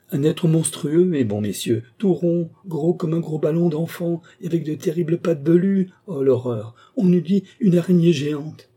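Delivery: horrified gardener. Acted. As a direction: describe the monster in mounting horror. Gardener describes the monster in horror.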